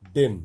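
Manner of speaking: ডেন said with a clear short e vowel, loud; teacher stresses ডেন with an e vowel; short e sound. Shown as a demonstration. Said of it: "এখানেও দেখো একার এসছে".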